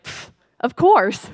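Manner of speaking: slow, scoffing pff, of course obviously; mock-dismissive scoff. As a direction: brush it off as too obvious. Scoffing at God's question.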